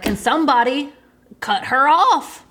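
slipping into full-blown Southern country; country accent. Real, not acted. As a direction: let a Southern country twang take over. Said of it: "what happened to my accent just now".

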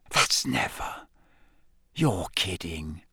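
slow, hushed disbelief; soft, low, awed whisper. A narrator's reading. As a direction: whisper it slowly, stunned. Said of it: "Ron whispered".

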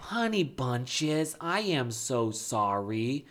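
very slow, syrupy mock apology; fake empathy tone. Husband performing empathy for wife, fake sorrowful tone. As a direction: draw out every word with put-on sympathy. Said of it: "it's this tone and it's these words".

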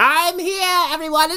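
put-on high cackly old witch.

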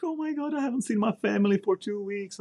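high, whiny mimicry of a homesick trainee; mock them in a high whining voice; a recruit moaning about missing his family, in a high mocking whine. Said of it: "Their little problems, like".

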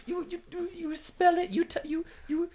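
very high, stammering fan character; mock fan voice. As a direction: squeak it out as a starstruck fan. Mock foreign fan explaining the name.

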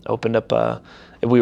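ordinary delivery

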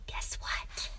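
hushed, breath-only tease; suspenseful whisper. A narrator's reading. Father teases his son mid-story, suspenseful whisper.

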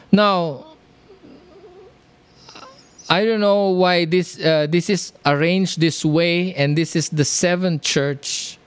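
usual speaking voice

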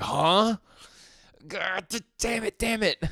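put-on a cornered boy cursing under pressure; Denji, flustered. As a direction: sputter in a high voice, losing it. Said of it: "And Denji's like".

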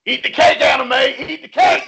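high yelled movie line; movie quote shout. Retold or imitated. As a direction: shout it like the film.

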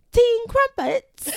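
high, loud, slow mock British accent. Shown as a demonstration. Pressed by co-host to perform, hammed-up British accent. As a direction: belt out an exaggerated British accent, drawn out and high. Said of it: "do your British accent for real".

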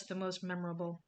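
ordinary talk

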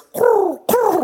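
high, loud laugh at himself; sudden loud high laugh; burst of laughter. Real, not acted.

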